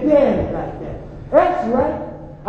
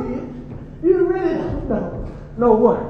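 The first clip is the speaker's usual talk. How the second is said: ordinary delivery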